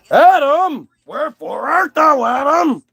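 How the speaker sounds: high lilting holler for someone hiding; sing-song calling out. Acted. God searching the garden for Adam, high playful calling. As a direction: call it out with big swoops in pitch.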